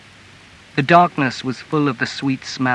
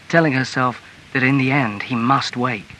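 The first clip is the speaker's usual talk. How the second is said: ordinary delivery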